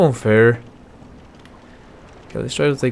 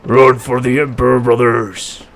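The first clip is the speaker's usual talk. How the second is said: Space Marine voice; 6 dB louder than usual. Acted. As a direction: bellow orders to your squad. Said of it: "That's my Space Marine voice".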